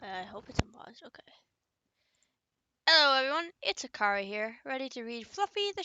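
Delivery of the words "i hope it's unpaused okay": whispering. Real, not acted. Quietly checking the recording.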